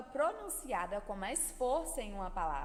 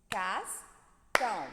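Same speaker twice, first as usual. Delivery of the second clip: "Cascão" split slowly into CAS, pause, CÃO; syllable by syllable; 2 semitones lower, 5 dB louder, much slower than usual. Shown as a demonstration. Teacher has pupils clap and count syllables, word split in beats. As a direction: count out "Cascão" in two separate beats, louder. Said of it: "bata palmas e conte as sílabas".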